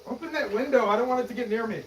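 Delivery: high, urgent plea; panicked demand. Acted. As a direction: order them urgently, scared for yourself. Allergic man fears the bee.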